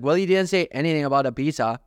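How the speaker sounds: ordinary talk, nothing marked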